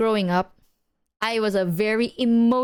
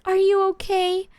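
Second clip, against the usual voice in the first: higher, gentle mothering voice; 8 semitones higher than usual. Retold or imitated. Mom checking on her child over a minor thing, gently cooing. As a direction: coo it like an anxious mother. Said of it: "she's like".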